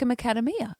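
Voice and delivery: "macadamia" mispronounced with no "day" sound; mum's odd pronunciation. Retold or imitated. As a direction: stress "KAD" in "macadamia". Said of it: "She says".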